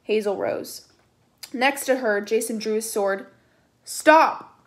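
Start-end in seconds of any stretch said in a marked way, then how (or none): 3.8-4.7 a higher, louder order that wavers; quavering order. A narrator's reading. Piper ordering the fighting friends to stop, quavering. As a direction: command it but let fear crack through. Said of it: "Piper ordered, but her voice quavered".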